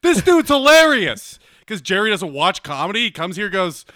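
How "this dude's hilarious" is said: imitating Seinfeld. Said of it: "Jerry Seinfeld was like".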